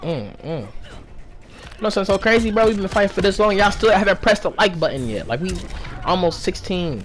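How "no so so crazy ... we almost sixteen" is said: hyped up. Real, not acted. Loud hype while fighting.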